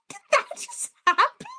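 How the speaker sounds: high, retold stunned question; disbelief. Storyteller to Dylan after the theft, disbelieving. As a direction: ask it in mock disbelief. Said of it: "I said".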